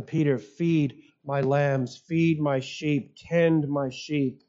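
slow, low, gentle instruction; tender charge. Acted. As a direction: entrust it to a friend, slowly and deliberately. Jesus gently commissions Peter.

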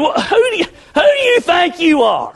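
high-pitched mock outrage; indignant retort. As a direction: throw it back as an offended challenge. Acts out his indignant response.

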